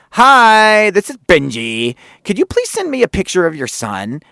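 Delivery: high-pitched, super happy phone greeting; imitation of an over-happy producer; Benji. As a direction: open on a loud, high, gleeful hello. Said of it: "like, high-pitched, super happy fucking voice".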